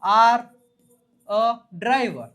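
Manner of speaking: each word spaced out, slow and loud; slow dictation. Shown as a demonstration. Teacher dictating to students, slow and deliberate. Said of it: "તો શું થાય?".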